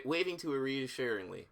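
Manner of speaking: usual speaking voice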